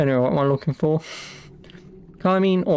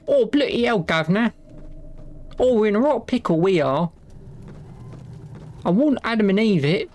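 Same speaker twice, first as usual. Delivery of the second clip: put-on cockney accent; 4 semitones higher than usual. Hammed-up cockney outburst.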